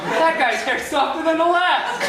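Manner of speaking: high, laughing coked-up marvel; wired passenger. Retold or imitated. Coked-up comic on the plane, high and giddy. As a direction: gush like a jittery high flyer.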